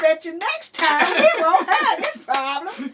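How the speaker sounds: loud, high knowing threat; mock threat. Grandma vowing to call the parents, loud and gleeful. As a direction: promise trouble, gleefully.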